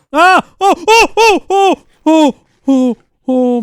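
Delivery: high panicked oh oh oh; startled yelps. Real, not acted. Gamer spooked by a monster, panicked yelps. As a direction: yelp in sudden fright.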